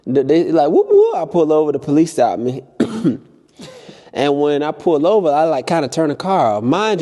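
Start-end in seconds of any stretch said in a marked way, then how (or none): 0.7-1.2 high whooping siren sound; siren imitation. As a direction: mimic a police siren, high and loud. Mimicking police siren.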